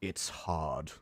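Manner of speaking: low, soft, slow growl of a voice; low quiet Fisk. Retold or imitated. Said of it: "and he just goes".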